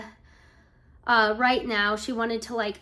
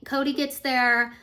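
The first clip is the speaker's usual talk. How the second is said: ordinary talk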